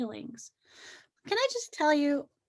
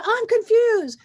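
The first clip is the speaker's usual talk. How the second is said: higher and louder, a hammed-up confused sigh; mock confusion; 9 semitones higher, 8 dB louder than usual. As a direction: play the bewildered self, overdone. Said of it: "And so you go".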